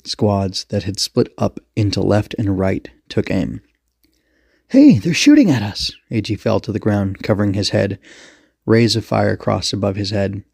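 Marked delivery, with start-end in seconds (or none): 4.7-5.9 high, loud panicked shout; startled, indignant yelp; alarmed cry. Acted. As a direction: cry out under gunfire. Character under fire cries out.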